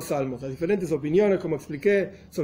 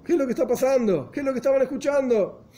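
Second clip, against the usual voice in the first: high, fast startled asking; the amazed students blurt out their question; stunned disbelief; astonished question; 7 semitones higher, faster than usual. Retold or imitated. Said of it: "totalmente sorprendidos".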